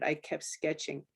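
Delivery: usual speaking voice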